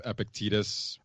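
Epictetus said "epic-TEE-tus"; Epictetus with a long EE. Shown as a demonstration. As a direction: make the middle of Epictetus sound like "teat". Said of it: "I hear teat".

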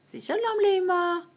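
high squeaky coaxing; high sing-song coaxing. Acted. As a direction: pitch way up to coax the bird. Coaxing parrot to speak.

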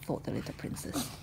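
ordinary delivery

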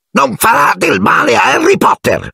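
high elf voice, defiant cry; Dobby, exclaiming. A narrator's reading. Elf shields Harry Potter from Malfoy, exclaiming. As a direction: exclaim it protectively as a squeaky elf. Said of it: "Ma Dobby esclamò".

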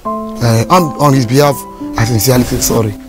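calm the caretaker down gently; sincere apology. Acted.

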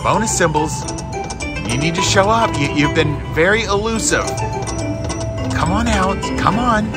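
usual speaking voice

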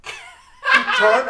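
loud, high excited telling; excited shout. Retold or imitated. Telling friends how the sandwich landed, loud laughing shout. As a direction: shout it out with glee.

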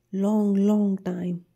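drawn out. Acted. Drawing out a wish to be held.

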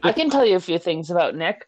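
ordinary talk

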